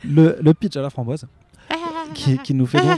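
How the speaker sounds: jokey showman restart of the pitch; playful mock announce. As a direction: announce le pitch like a silly game-show host. Silly restart after friend's teasing.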